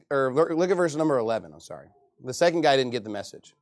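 normal talking voice

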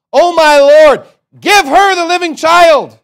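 high, very loud drawn-out cry; wailed, full-voiced begging; desperate plea. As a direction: cry out to the king for mercy. Acts out mother's plea to king.

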